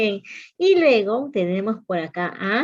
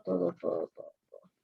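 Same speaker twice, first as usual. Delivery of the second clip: fading whisper; 4 semitones lower, 11 dB quieter, much faster than usual. Shown as a demonstration. Trailing to whisper.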